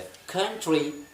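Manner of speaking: slow कंट्री with kh onset; aspirated k. Shown as a demonstration. Teacher slowly modelling aspirated कंट्री. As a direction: stretch कंट्री, puffing the k. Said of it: "convert कर दिया है ख के अंदर".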